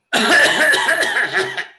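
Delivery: loud run of coughs; bouts of cough. Shown as a demonstration. Teacher demonstrates coughing in bouts, loud sequence. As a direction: cough in a string of bursts. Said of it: "now it is bouts".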